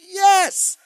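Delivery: high, eager shout of recognition; finally someone else gets it too; excited. Retold or imitated. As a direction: leap on it with delight. Jennifer excited, shout heard.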